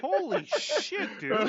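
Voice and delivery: loud, high, drawn-out amazement; astonished outburst. Real, not acted. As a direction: blurt it out in real disbelief, stretching the words. Reacting to a huge attack roll.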